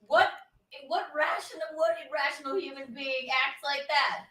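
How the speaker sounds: indignant outburst. Real, not acted. Cohost ranting about the host's rival, rising indignant shout. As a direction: build the outrage to the punchline.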